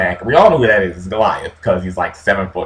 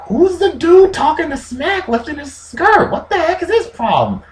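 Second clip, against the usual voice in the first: put-on high puzzled young David voice. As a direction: wonder aloud, high and bewildered. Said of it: "David ... is like".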